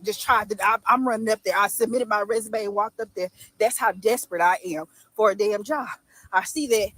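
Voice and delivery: normal talking voice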